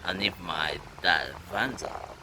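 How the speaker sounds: ordinary talk, nothing marked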